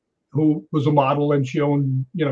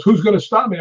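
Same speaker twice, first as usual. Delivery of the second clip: higher, louder imitation of a dare; mock challenge; 6 semitones higher, 5 dB louder than usual. Intruder daring anyone to stop him, mock challenge. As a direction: play a cocky challenger, lightly acted.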